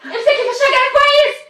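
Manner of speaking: high, urgent frightened calls; panicked mother. Acted. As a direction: cry out to your falling child. Mother sees daughter about to fall.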